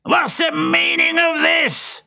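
slow, high-pitched outraged roar; thundered. A narrator's reading. Pantalone, squashed flat in the street, demands an explanation, thundering. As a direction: thunder it out, strained high and drawn out. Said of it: "he thundered".

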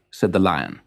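usual speaking voice

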